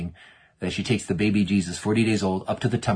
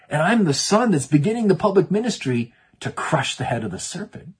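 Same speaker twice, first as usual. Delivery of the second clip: high, raised, fervent preacher's dialogue; impassioned revelation; 8 semitones higher, 4 dB louder than usual. Acted. Jesus reveals his mission to his mother, impassioned. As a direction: build it up as a momentous declaration.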